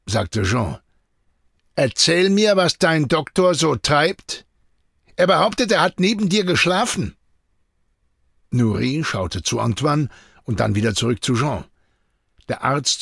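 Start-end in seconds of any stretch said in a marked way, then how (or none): 1.7-7.1 raised, pressing demand; stern interrogation. A narrator's reading. Jean checks the alibi with Nuri, stern interrogation. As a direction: press the assistant for answers.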